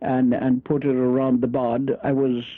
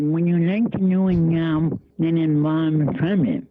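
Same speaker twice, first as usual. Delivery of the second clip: imitating the man's cleft palate speech, words blurred; 3 semitones higher than usual. Retelling the stranger's job offer, imitating his cleft palate speech. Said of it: "the man had a cleft palate".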